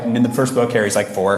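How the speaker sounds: ordinary talk, nothing marked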